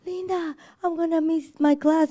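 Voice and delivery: high, whiny mock crying plea; imitating crying brother. Older sister imitates crying eleven-year-old brother, mock whiny. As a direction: mimic a little brother wailing on the phone. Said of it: "he calls me crying".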